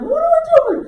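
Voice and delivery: high-pitched frantic screaming; panicked wife. Retold or imitated. Husband imitating his panicked wife on the water, shrill scream. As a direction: shriek orders in sheer panic. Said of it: "just panicked. She's like".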